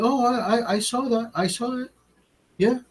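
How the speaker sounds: squeaky high Mexican-accent impression of the coworker; imitating Mexican accent. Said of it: "sounds like a Mexican when he talks".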